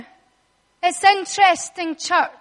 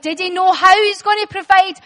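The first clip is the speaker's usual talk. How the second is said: ordinary talk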